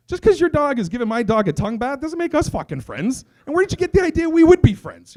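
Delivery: high-pitched, worked-up rebuff; indignant. Retold or imitated. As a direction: tell off an overfriendly stranger, comically outraged. Acted indignant rebuff of dog owner.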